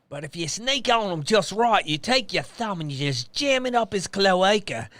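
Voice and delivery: high, broad croc-hunter Australian accent. Shown as a demonstration. Wildlife guide giving croc advice, Australian accent. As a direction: explain it in a hammed-up Australian accent. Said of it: "my go-to with that is".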